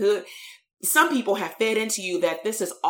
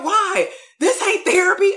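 imitating the boy, much higher and louder; mock outraged teenager, pitched way up; high-pitched retold protest; 11 semitones higher, 6 dB louder than usual. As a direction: squeak out the teen's indignant complaint. Kid protesting at the restaurant.

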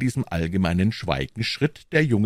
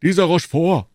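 raised, louder exclamation; higher, louder outburst; calling out; 9 semitones higher, 8 dB louder than usual. A narrator's reading. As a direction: exclaim the name loudly. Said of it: "rief Porthos".

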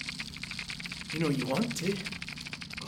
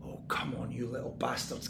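mutter; 8 semitones lower, 10 dB quieter than usual. Acted. Frustrated muttering at the walls.

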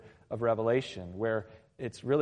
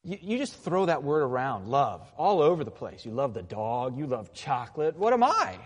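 mock temper tantrum. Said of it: "throw that little temper tantrum".

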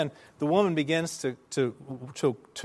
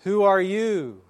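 high, raised call, slightly less forceful; imitated echo reply; 5 semitones higher, 5 dB louder, slower than usual. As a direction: play the valley calling back. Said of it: "the reply came back".

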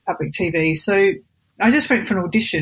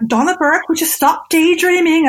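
high, louder mock telling-off; teacher's raised-voice reprimand; scolding teacher; 8 semitones higher, 4 dB louder than usual. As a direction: imitate an exasperated teacher. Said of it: "all my teachers, you just be like".

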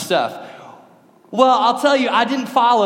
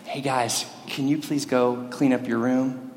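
mock-gentle, low and soft request; hushed, low, even-tempered parent voice; calm dad; 7 semitones lower, 6 dB quieter than usual. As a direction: ask sweetly and quietly, like a patient father. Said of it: "I'm such a calm dad".

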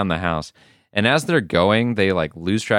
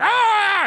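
mock scream. T-bone steak runs past screaming, high mock yelp. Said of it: "and he's like".

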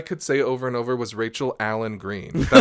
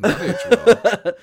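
laughing; 9 semitones higher, 8 dB louder, slower than usual. Real, not acted. Laughing at an old joke.

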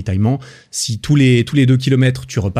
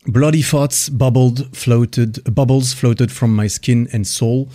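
slow dramatic reading; much slower than usual. Acted. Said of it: "je vais citer quelques passages du livre".

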